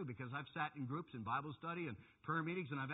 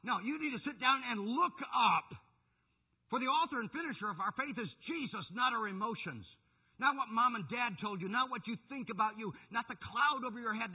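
loud, high, emphatic exhortation; impassioned preaching; 8 semitones higher, 8 dB louder than usual. Real, not acted. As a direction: drive each phrase home to the congregation. Preaches at full force.

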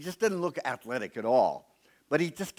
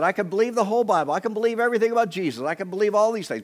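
fast, slightly higher student voice; lightly voiced student; 8 semitones higher, 6 dB louder, much faster than usual. Preacher voicing a skeptical student rattling off beliefs, fast. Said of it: "They came to me and they said".

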